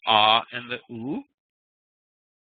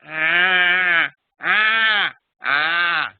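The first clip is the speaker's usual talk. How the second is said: put-on high, loud baby "ah" calls; baby vocalizing; 4 semitones higher, 5 dB louder, much slower than usual. Teacher imitating a baby's first sounds, high and loud. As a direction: coo out "ah" like a baby trying its voice. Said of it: "making sounds like".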